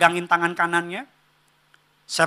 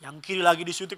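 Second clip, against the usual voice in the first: ordinary talk, nothing marked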